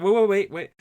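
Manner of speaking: loud, high panicked wait; panicked shout. Acted. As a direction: stop them in a panic. Anakin pleading before being killed.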